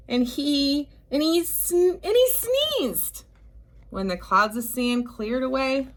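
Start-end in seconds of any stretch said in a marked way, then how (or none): 0.1-3.2 stammered build, rising higher to a sudden sneeze; mock sneeze build-up, voice leaping higher. A narrator's reading. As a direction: climb up and burst out on sneezed.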